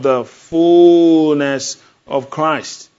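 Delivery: slow, loud, drawn-out; booming scripture climax; proclaiming. Acted. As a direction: stretch fullness like a crowning line. Preacher climax of scripture reading.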